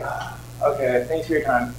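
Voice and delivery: quiet defeated thanks; deflated. Retold or imitated. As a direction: give up politely, crushed. Deflated after refusal.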